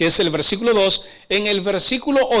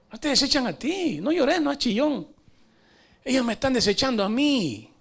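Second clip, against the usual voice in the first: softer, higher consoling voice; God; 3 semitones higher, 5 dB quieter than usual. Acted. God consoling a crying Samuel, soft tender reassurance. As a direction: console gently, hushing a tearful old man. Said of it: "Y le dice Dios, Eh, Samuel".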